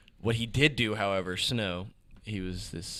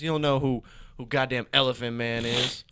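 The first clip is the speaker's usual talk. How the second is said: ordinary talk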